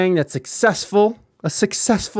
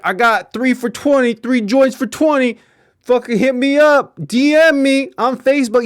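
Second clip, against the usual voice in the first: higher, louder hustler pitch; imitating a careless dealer friend; 6 semitones higher, 3 dB louder than usual. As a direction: hawk weed like an open ad. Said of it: "he was just like".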